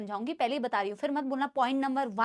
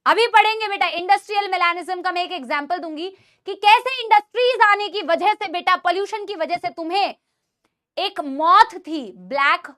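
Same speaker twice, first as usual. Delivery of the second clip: loud class voice; 3 semitones higher, 6 dB louder than usual. Real, not acted. Teacher back to her loud classroom voice, energetic. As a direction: project loudly to the whole class. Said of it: "मेरी classes में मैं ऐसे ही बोलती हूँ".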